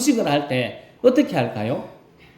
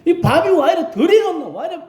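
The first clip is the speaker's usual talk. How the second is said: complaining grumble; 13 semitones higher than usual. Retold or imitated. Church elder griping about the lunch to the cooks, whiny. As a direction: whine about the food like a picky old man. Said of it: "막 이렇게 이야기할 필요가 없습니다".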